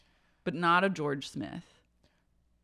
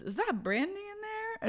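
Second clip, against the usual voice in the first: very high, soft surprised query; imitating Phaedra; mock Phaedra; 7 dB quieter than usual. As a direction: peer in, puzzled. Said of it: "She goes".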